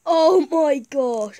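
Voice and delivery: normal talking voice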